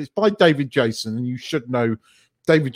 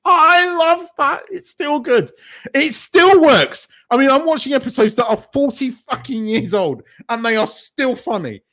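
excited raving; 12 semitones higher than usual. Real, not acted. Fan raving to co-host about an old sitcom, excited. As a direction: gush in a raised, emphatic voice.

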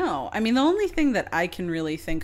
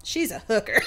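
blunt line through laughter; Stacy's deadpan; 3 semitones higher, 4 dB louder than usual. Retold or imitated. Stacy answering her mother bluntly, half laughing. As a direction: drop it flatly, half laughing. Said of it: "And Stacy just goes".

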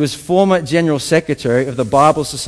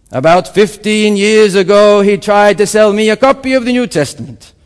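put-on loud, high-pitched African-accented oratory; 4 semitones higher, 7 dB louder than usual. Speaker telling the audience about the Testament, loud African accent. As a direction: project like an African speaker at a podium. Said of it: "in obviously African accent".

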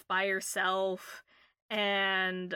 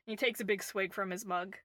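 usual speaking voice

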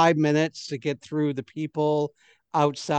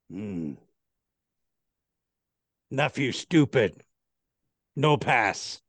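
slow dim guard voice; 3 semitones lower than usual. Acted. Guard refuses, judges nephew.

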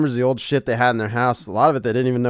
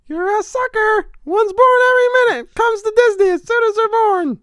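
high squeaky cartoon mouse; Mickey Mouse voice; 7 dB louder than usual. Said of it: "my Mickey impression".

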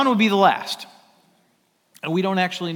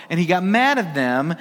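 ordinary delivery

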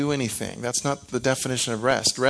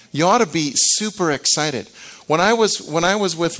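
loud, high burst of enthusiasm; excited; 5 semitones higher, 4 dB louder than usual. Real, not acted. Preacher telling the crowd to be excited, loud and high. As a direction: bubble over with excitement.

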